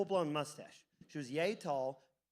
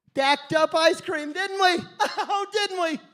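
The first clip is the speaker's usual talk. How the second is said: much higher and louder, laughing through the words; 12 semitones higher, 12 dB louder than usual. Real, not acted. Comic remembering the fake cones, breaking into real laughter. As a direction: crack up as you say it. Laughing at himself while retelling.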